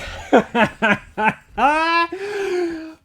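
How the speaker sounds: high, loud laugh of disbelief; real high-pitched victory laugh; elated laugh. Real, not acted. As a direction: burst out laughing in triumph. Laughs at sudden success.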